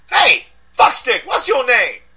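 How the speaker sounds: high, loud yell away from the phone. A narrator's reading. Deshaun yelling at the arresting detective, high and loud. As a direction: shout rudely at the cop. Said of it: "I heard him yell".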